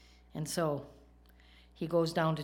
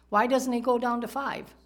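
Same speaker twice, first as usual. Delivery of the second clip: higher and louder rhetorical question; emphatic question; 5 semitones higher, 6 dB louder than usual. Real, not acted. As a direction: press the crowd with it. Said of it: "He stops at ten".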